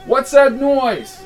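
high, slow call over noise; shouting. Acted. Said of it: "cupped his hand to the side".

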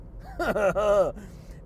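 a long amused laugh. Real, not acted. Vlogger laughing at his own question. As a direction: laugh it out at yourself.

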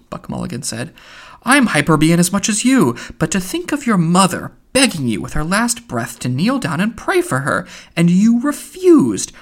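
indignant rebuke, rising on the refusal; reproachful Buck Mulligan. Acted. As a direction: accuse him with mock outrage.

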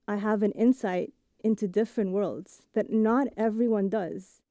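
usual speaking voice

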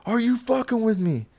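a scared buddy squeaking out an accusation; panicked friend. Retold or imitated. Friend on the couch accusing him, high and panicked. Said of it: "I hear him say".

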